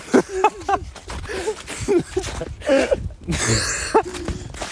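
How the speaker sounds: high squealing laugh. Real, not acted. Friend laughs at his own teasing, squealing.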